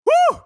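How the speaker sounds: high, loud whoop of triumph; celebratory whoop. Real, not acted. Host whoops as the rant ends, loud celebration. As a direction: let out a big woo.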